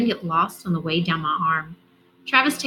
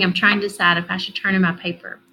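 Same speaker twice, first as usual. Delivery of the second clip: ordinary delivery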